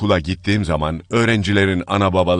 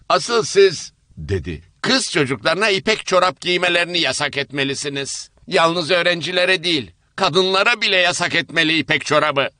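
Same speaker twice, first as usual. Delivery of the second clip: higher, insistent moralizing voice; seated woman scolding about silk stockings; prim indignant woman; 10 semitones higher than usual. Acted. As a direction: demand the ban firmly. Second woman demands ban.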